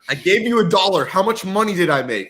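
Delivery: high, loud pushy executive voice; demanding boss. Pretend. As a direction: play the impatient CEO asking.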